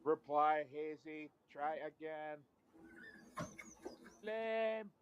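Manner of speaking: flat magic-ball robot reply. Acted. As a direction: answer like a machine. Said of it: "it's the robot".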